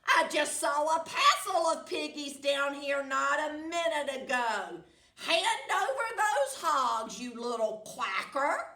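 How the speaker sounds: loud, high scolding witch; angry witch. Acted. Witch scolding the duck to hand over hogs. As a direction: demand the hogs like a cranky witch.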